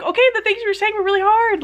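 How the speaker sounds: high, fast re-enacted objection; retold indignant complaint, quick and high; exasperated protest. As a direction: push back in a rush, pitch up. Retells protesting to the committee.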